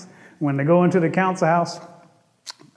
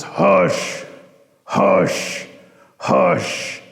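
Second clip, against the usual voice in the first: bullfrog hush; 2 semitones higher, much slower than usual. Acted. Bullfrog silences Rabbit.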